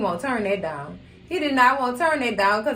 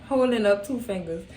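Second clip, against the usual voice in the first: normal talking voice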